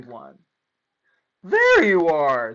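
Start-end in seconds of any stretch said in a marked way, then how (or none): 1.4-2.5 a hint of a relieved teacher; slow, loud relieved call; teacher finding him. A narrator's reading. Teacher finds the lost pupil, mildly relieved. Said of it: "said Missus Inky Dink".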